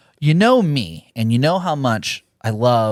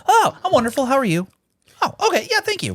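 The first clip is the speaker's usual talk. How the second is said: much higher, sing-song customer service cheer; fake cheerful work voice; 12 semitones higher, faster than usual. Answering a caller at work, fake-cheerful falsetto. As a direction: chirp it brightly, hiding the bad day. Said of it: "putting on the facade at work".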